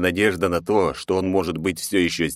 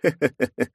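evil laugh; 7 semitones higher than usual. Acted. Narrator threatens torture.

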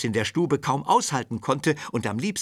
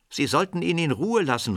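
a lightly voiced boy calling out, raised; a boy's mild outburst, higher and louder; 7 semitones higher, 4 dB louder than usual. A narrator's reading. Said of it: "rief Serjoscha".

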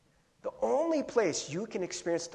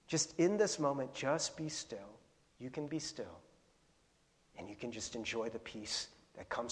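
tender God voice; 5 semitones lower, 6 dB quieter than usual. Retold or imitated. God's voice speaking tenderly.

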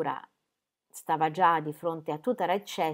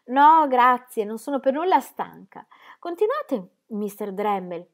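higher, lightly mocking duchess voice; a teasing old duchess, amused; 6 semitones higher, 6 dB louder, slower than usual. A narrator's reading. The duchess teasing the bishop, amused.

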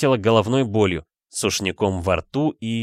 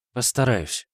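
serious; 3 semitones higher, slower than usual. Acted. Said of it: "совершенно серьёзно ответила она".

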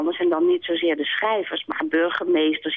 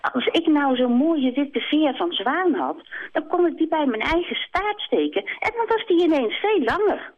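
higher, excited hen plotting; scheming hen; 9 semitones higher than usual. Acted. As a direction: sell the plan brightly. Kip scheming.

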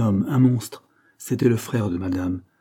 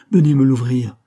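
raised, higher cry to be let out; shouted call; 5 semitones higher, 9 dB louder than usual. A narrator's reading. Locked-in governess to the servants, shouting to be let out. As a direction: call out loudly through the door. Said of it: "leur criai-je".

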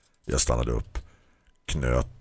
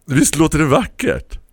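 delighted; 10 dB louder than usual. A narrator's reading. Hon strålar.

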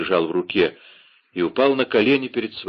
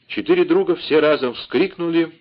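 ordinary delivery, nothing marked

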